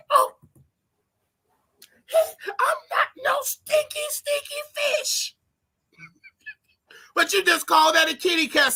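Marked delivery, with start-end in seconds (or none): 0.1-5.3 high, slow mock-offended squeal. Mocking critics who hate the word fish, high offended squeal. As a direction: squeal it like you're insulted.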